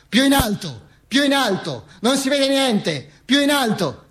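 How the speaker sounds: high, insistent crowd demand; crowd call. Acted. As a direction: call out over a crowd in a high, falling cry. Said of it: "gridava la gente". (grido di folla, acuto e insistente)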